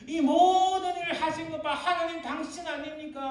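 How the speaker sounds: high, loud accusing cry. Acted. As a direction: cry out in protest to God, pitched high. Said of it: "이렇게 외치는 거예요". (하나님께 항의하듯 높고 크게 외침)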